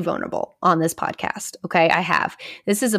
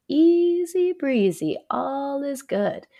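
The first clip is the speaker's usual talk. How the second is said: breezy fake fine; 7 semitones higher, much slower than usual. Mocking her own I'm-fine facade.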